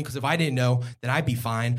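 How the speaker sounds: normal talking voice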